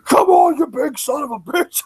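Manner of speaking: high-pitched, riled-up mock shout; imitating worked-up wrestler. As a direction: egg him on, imitating a fired-up giant. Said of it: "he got all worked up".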